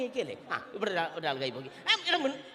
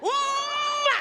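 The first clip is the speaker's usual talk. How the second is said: a big, very high smacking kiss sound; loud mock kiss; 5 dB louder, much slower than usual. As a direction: plant a loud playful kiss on the bald head. ഒരു ഉമ്മ കൊടുക്കട്ടെ. (ഉച്ചത്തിലുള്ള കളിയുമ്മ)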